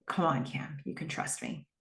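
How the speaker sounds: hushed plea, sinking toward a whisper; very quiet. Retold or imitated. Joe asks tearful Cam to trust him, very quietly. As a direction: say it very softly, almost voiceless by the end. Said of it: "He says very quietly".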